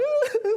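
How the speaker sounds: a quick high burst of laughter; short high laugh. Real, not acted. Laughs recalling the song moment.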